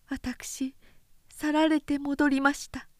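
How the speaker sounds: a hint of the meek sister returning home; young sister's voice. A narrator's reading. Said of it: "叱りつけるように言った". (少し高めの控えめな妹の声)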